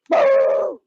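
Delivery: very high drawn-out "boo" hoot. Pretend. Searcher out after missing dog, high-pitched hoot. Calling noise during search.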